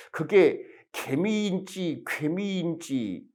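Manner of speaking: 개미 and 괴미 drawn out slowly, each vowel clear; two pronunciations. Shown as a demonstration. Lecturer contrasting 개미 and 괴미 for students, slow careful pronunciation. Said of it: "개미라고 그렇게 발음하는 분들도 있고".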